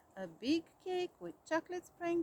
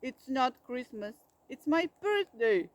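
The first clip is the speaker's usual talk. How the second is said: indignant bear; 9 dB louder than usual. A narrator's reading. Bear scolding the fake Santa.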